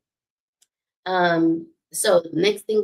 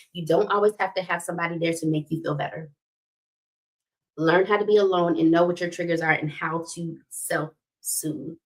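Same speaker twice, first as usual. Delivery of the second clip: ordinary talk, nothing marked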